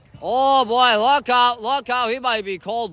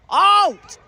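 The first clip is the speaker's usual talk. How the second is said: sharp high shout of the call; excited call; 9 semitones higher, 5 dB louder than usual. Real, not acted. Commentator calling the ball out, loud excited shout. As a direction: bark the call over the crowd.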